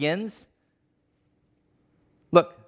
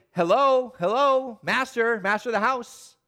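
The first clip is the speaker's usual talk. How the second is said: raised, higher calls to the master through the door; pleading visitors calling out; 6 semitones higher than usual. Acted. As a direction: call out hopefully to the locked house. Shut-out visitors knocking at night.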